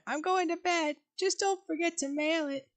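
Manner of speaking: put-on high, soft elderly mom; a lightly voiced old mother. Penny voiced in read script.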